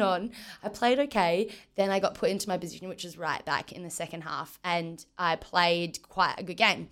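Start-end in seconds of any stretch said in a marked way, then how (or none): none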